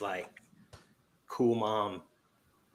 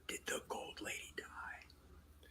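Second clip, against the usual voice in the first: slow hushed whisper; 20 dB quieter than usual. Real, not acted. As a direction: whisper to dodge a spoiler. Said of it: "I can't hear you when you whisper".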